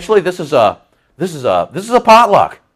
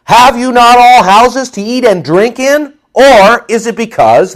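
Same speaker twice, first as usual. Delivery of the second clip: loud, slow, higher-pitched rebuke; no punches pulled; 6 semitones higher, 5 dB louder than usual. Acted. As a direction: confront them, pounding out each word. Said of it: "not pulling back any punches at all".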